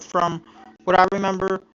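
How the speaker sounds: ordinary delivery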